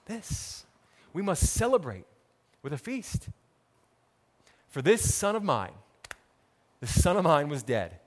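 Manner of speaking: normal talking voice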